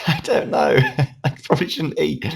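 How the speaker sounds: high laughing words. Real, not acted. Laughing at own eating.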